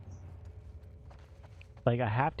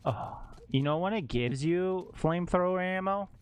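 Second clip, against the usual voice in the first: ordinary delivery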